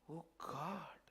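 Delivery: hushed gasping whisper; soft frightened whisper. Acted. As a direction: breathe it out in shock. Peering down into the water.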